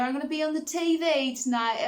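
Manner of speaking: high-pitched imitation English accent; mock English accent. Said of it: "it was in an English accent".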